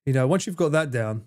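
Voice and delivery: ordinary talk, nothing marked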